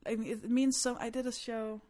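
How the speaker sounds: usual speaking voice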